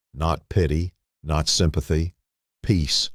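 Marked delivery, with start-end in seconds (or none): none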